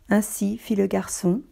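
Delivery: ordinary delivery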